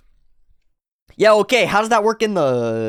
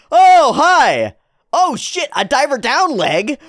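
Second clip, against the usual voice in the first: loud, high startled greeting; shouted surprise, pitched way up; 11 semitones higher, 6 dB louder than usual. Real, not acted. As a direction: jump at the sudden appearance. Sudden enemy appears.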